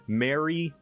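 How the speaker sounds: slow, clear Mary; careful vowel. Shown as a demonstration. Asking a friend about vowels, slow careful Mary. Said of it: "actively adjust how I say a word".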